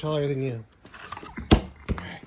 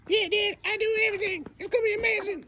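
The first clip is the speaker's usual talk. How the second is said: put-on much higher, squeaky little kid voice; excited baby voice, much higher; high toddler voice; faster than usual. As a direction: speak for the toddler in a tiny high voice. Dad voices toddler's reply.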